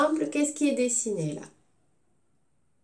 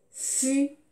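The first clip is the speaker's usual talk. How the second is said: high isolated syllable; single syllable on a raised note; piano key sound; 4 semitones higher than usual. Shown as a demonstration. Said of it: "on peut imaginer qu'ils font des sons".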